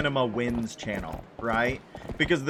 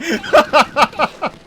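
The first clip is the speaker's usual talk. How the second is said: a big burst of high laughter; loud high laugh; 11 semitones higher, 10 dB louder than usual. Real, not acted.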